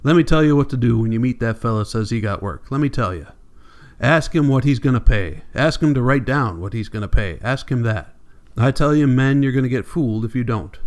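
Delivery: ordinary delivery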